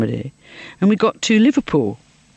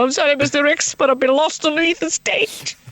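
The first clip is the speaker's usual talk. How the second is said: high Scouse stage apology; Liverpool accent; 7 semitones higher than usual. Acted. Lost actress apologising to the director, high Liverpool accent. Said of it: "gone back to Liverpool".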